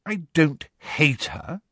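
haughty and measured; lofty. A narrator's reading. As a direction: deny it loftily, drawing the words out. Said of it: "said Hermione loftily".

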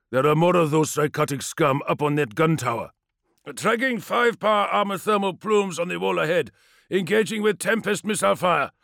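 loud, high commands over gunfire; shouted battle orders. Acted. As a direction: bark orders across the field. Combat orders over the vox.

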